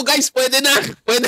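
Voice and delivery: gleeful cry that it's now allowed; excited high-pitched quote. Retold or imitated. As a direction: pitch it way up like you just discovered a loophole. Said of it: "Sabi ko".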